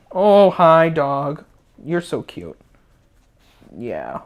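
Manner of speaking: cooing to dog. Real, not acted. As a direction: coo at the puppy.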